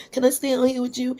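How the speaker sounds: put-on high, quick childish plea; pleading schoolgirl. Pupil begging dean to stay out, high pleading voice. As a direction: beg fast in a kid's high voice.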